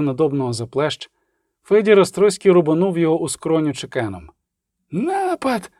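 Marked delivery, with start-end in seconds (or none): 4.9-5.7 put-on very high young monk's cry, volume held back; thin, high-pitched mock shriek; shrieked alarm. A narrator's reading. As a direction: squeal the warning in alarm. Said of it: "заверещав юний ченчик".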